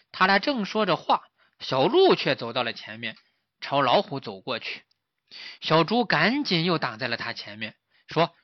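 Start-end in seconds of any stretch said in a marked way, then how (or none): none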